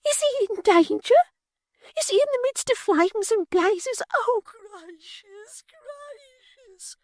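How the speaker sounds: very high, slow, tearful fake fright; a martyr bidding farewell, squeaking; Miggs, faint and fearful. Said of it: "cried Miggs".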